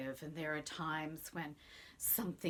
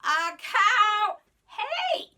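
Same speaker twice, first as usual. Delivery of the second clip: much higher, louder startled yelp; shocked outcry; 16 dB louder, much slower than usual. Acted. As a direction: shriek it in outrage. Old man shocked again.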